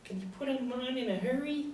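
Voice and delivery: high squeaky mock of Nick; whiny mocking voice. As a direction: mimic him like a timid pup. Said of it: "the little dog steps away and says".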